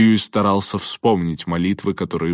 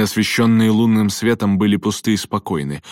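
ordinary delivery, nothing marked

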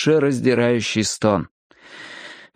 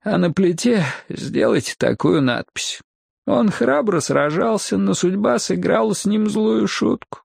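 higher, lightly plaintive deathbed voice; weak voice; 7 semitones higher than usual. A narrator's reading. Dying man dictates his gravestone inscription, mildly weak voice. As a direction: dictate your epitaph in a mildly feeble, raised voice. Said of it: "слабым голосом завещал я им".

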